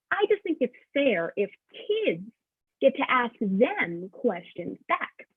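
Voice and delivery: usual speaking voice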